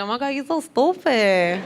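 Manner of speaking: put-on cute it up in a Puerto Rican accent; cutesy Puerto Rican accent. Girl she grew up with teasing, cutesy Puerto Rican accent. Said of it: "had that cutesy little accent".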